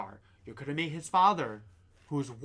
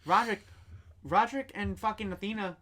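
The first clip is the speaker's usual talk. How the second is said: excited; 6 semitones higher than usual. Real, not acted. Excited agreement about demotions.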